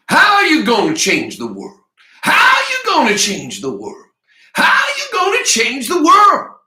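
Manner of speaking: high, loud scream building with each repeat; escalating full-voiced yell. A narrator's reading. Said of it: "now screaming".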